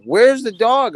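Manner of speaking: mock panic as you look for the dog; panicked. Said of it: "I'm like".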